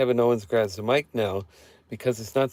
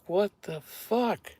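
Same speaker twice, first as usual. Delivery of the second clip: slow, drawn-out what the fuck, pitched up and soft; stunned disbelief; 8 semitones higher, 7 dB quieter, much slower than usual. Retold or imitated. Wrestling fan recalls a bad pairing, stunned and slow. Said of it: "I was just like".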